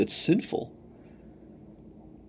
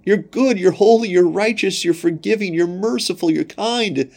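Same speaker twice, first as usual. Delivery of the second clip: much higher, fervent praise voice; adoring worshippers; 8 semitones higher than usual. Acted. Ephesians praising God, fervent and much higher. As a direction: pour out praise to God, pitched high. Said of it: "they were saying".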